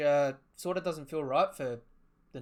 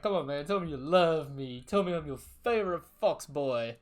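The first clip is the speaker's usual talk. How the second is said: loud, goading tease with raised pitch; baiting; 4 semitones higher, 8 dB louder than usual. Retold or imitated. Kuruma baiting him to admit love, loud teasing. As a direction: egg him on loudly, fishing for affection. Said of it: "Kuruma's baiting him, just like".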